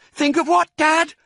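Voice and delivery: put-on very high, loud child voice; small fox child. A narrator's reading. Small fox questioning Dad, eager child. Child asks excited father.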